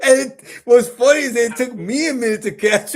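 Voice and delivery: can barely get the words out for laughing. Real, not acted. Retelling the joke to his friend, laughing hard. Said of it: "laugh cry".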